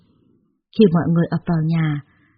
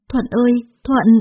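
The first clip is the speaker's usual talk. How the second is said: halting, higher stammer; 5 semitones higher than usual. A narrator's reading. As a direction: fumble his name. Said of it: "tôi ấp úng". (ấp úng gọi tên Thuận)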